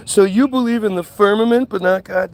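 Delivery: loud, high mock challenge; imitating an incredulous challenger; mocking quote. Mockingly reading out a challenger's comment.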